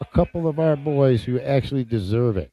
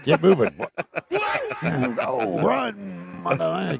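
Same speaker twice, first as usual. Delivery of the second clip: silly voice; 7 semitones higher, much slower than usual. Pretend. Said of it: "just try some silly voice".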